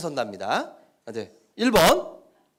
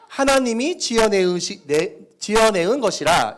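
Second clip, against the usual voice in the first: North Korean dialect; 3 semitones higher, 6 dB louder than usual. Shown as a demonstration. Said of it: "북한 사투리를 쓴다고 생각하고".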